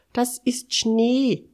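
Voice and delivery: high, loud and drawn out; excited calling out. A narrator's reading. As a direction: stretch the exclamation slowly, high and loud. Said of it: "rief Mister Quatschkopf". (laut und gedehnt ausrufen)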